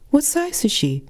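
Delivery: saleswoman's voice. Retold or imitated. Narrator voices eager bra saleswoman.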